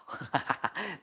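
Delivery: short amused chuckle; quiet laugh to oneself mid-lesson. Real, not acted. Amused aside while choosing chalk colour. (나직이 터진 웃음)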